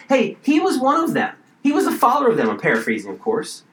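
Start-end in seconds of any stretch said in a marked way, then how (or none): 0.1-2.5 high-pitched, lightly acted accuser; accusing servant girl. Servant girl tells the people around her about Peter, accusing. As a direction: point him out to onlookers, pitched up high. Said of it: "tells the people around her".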